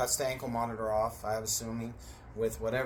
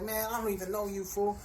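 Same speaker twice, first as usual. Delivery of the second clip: angry retort; 7 semitones higher than usual. Retold or imitated. Yelling at the informant.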